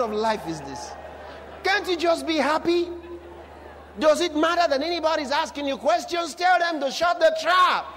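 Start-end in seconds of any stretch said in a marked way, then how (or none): 1.6-7.8 high, impassioned preaching building to a shout; forceful exhortation. Real, not acted. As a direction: scold the congregation into being happy.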